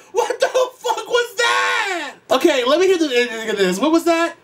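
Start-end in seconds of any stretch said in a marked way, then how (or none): 0.1-2.1 very high, drawn-out shocked question; slow, high-pitched astonishment; shocked disbelief. Real, not acted. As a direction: squeal it out in amazement. Shocked by the song's odd ending.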